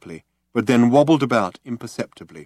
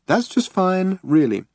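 high, sing-song reassurance; lilted; 8 semitones higher, slower than usual. A narrator's reading. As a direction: soothe him in a lilting voice. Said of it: "lilted Trillian".

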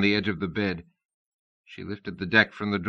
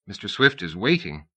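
plain reading voice

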